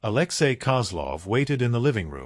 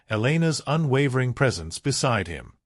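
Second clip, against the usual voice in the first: ordinary delivery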